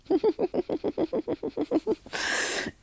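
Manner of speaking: high-pitched rapid villain giggle; long, manic falsetto cackle; maniacal laugh. Acted. Character laughing at the people he just paralyzed, maniacal high giggle. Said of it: "he starts laughing maniacally".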